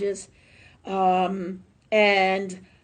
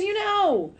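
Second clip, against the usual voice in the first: much higher, louder, sing-song coaxing; high coaxing dog-talk voice; 6 semitones higher, 4 dB louder than usual. Retold or imitated. To her dog acting up, high coaxing voice. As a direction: coo it up high like talking to a pet. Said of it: "I'd be like".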